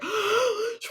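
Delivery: high, sharp intake of breath; horrified gasp. Retold or imitated. Author reacting to the director's admission, horrified gasp. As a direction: gasp in mock horror.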